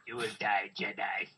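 low, soft evil emperor voice; Emperor Palpatine. Acted. Riffer playing the emperor to a Jedi, low menace. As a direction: threaten in a low, hushed villain voice. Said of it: "Emperor Palpatine".

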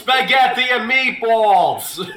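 hammed-up Italian accent lifeguard shout; Italian Mark Wahlberg shouting. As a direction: bellow at beachgoers in a cartoon Italian accent. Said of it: "what would Italian Mark Wahlberg sound like".

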